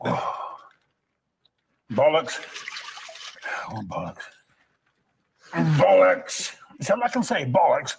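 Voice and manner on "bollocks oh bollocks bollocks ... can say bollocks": bursts of shouted bollocks, varying pitch; berserk rage. Acted. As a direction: swear again and again in fury. Repeated outbursts in takes.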